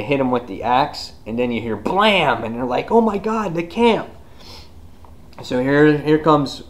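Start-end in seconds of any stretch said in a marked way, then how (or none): 2.9-4.1 high-pitched cry of mock alarm; alarmed mock gasp. Hunters hear a blam from camp, high mock alarm. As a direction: pitch it up in sudden mock panic. Acting alarm on hearing the gunshot.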